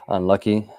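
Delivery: normal talking voice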